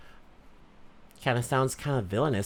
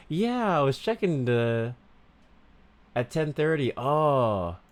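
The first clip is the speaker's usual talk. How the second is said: put-on Valley Girl voice. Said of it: "ten minutes of Valley Girl".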